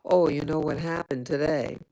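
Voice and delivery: normal talking voice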